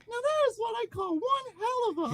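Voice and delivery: very high falsetto ring character; high-voiced LaFranc. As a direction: squeal it out in a put-on falsetto. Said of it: "oh, I'm sorry".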